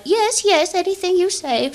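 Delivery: put-on high, frail old-lady voice; eighty-five-year-old great nan agreeing to the plan. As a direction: play a tiny eager great-grandmother.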